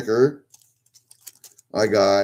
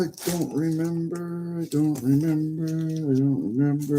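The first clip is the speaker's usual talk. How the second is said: mock sing-song; 7 semitones higher than usual. Sing-song repeat of own line.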